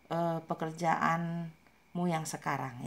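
ordinary talk, nothing marked

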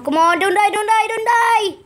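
real panic rising in pitch; frantic pleading. Real, not acted. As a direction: beg the game not to let you die. Pleading as character nearly dies.

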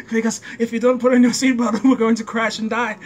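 put-on parental alarm breaking into laughter; mock scolding father. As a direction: imitate a dad's dire warning, amused.

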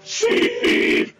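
very high, loud shriek. Real, not acted. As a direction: shriek in panic. Sudden cry during gameplay.

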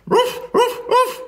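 high-pitched little dog yap; sharp, squeaky ruff; dog bark. A narrator's reading. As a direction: bark like an excited small dog. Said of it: "went Ebb".

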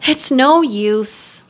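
slow, mournful tiger; understated, slow lament; sad. A narrator's reading. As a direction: draw it out in resignation. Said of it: "said the tiger sadly".

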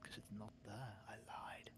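sly whispered confession; whispering. Pretend.

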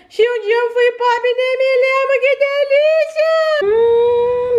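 high, delighted cartoon voice; a spoiled doll in bliss; cartoon princess. Doll character gloating over popsicles.

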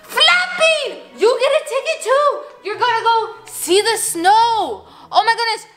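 high-pitched, thrilled delivery; very excited. Real, not acted. Giving Fluff Bean a snow ticket, excited. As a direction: burst in high, easing slightly. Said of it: "get excited".

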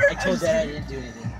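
ordinary talk

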